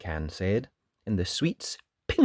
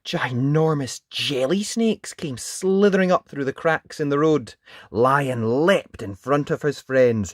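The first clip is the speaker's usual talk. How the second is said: animated narration, higher on big moments; children's storytelling; 4 semitones higher, 5 dB louder than usual. A narrator's reading. Narrator describing the snake attack, animated storytelling. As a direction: read it vividly to small children.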